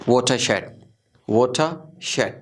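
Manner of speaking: watershed as "wota-shed", no R; silent R. Shown as a demonstration. Teacher modelling watershed for learners, silent R. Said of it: "बस ये sound आएगी".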